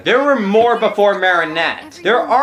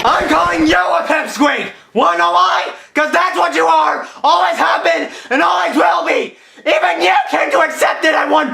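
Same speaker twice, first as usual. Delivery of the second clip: furious shouting; 10 semitones higher than usual. Acted. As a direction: scream it right back at him. Ranting back at the pipsqueak clip.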